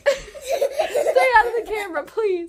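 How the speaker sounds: high laughing sign-off. Real, not acted. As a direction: giggle through the goodbye. Laughing, child in frame.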